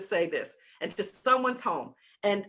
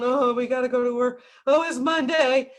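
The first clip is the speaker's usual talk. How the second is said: groaning employees; 3 semitones higher, 5 dB louder than usual. Retold or imitated. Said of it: "the employees come, they put their coffee down".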